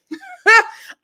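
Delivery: loud, high burst of laughter; sudden shocked laugh. Real, not acted. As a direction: crack up at the harsh line. Reacting to a harsh line.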